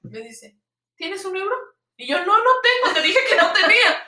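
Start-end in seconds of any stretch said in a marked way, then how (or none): 1.0-1.5 high, soft little ask; wheedling rose seller. Retold or imitated. Seller asks for money after giving the rose, high wheedle. 2.2-4.0 high, insistent protest; retold exasperated refusal. Tourist refuses the seller's demand for money, high exasperated protest.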